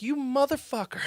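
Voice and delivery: high-pitched mock-insult of adoration; high affectionate curse. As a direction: squeak it lovingly. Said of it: "I was like".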